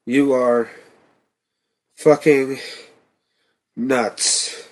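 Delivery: panting between words; breathless, gasping out words; out of breath. A narrator's reading. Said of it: "in between pants".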